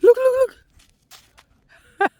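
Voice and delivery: excited calling. Real, not acted. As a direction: point it out, thrilled. Spotting something at the zoo.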